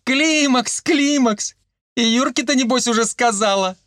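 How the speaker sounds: high mocking female voice; teasing woman. A narrator's reading. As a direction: tease her in a high, merry voice. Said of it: "громко захохотала Марийка". (насмешливо, высоким женским голосом)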